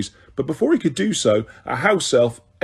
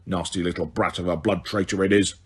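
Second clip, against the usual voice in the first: low, soft croaked insult; audible elf mutter; 6 semitones lower, 6 dB quieter than usual. A narrator's reading. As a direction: mutter it so they can still hear. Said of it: "he added perfectly audibly".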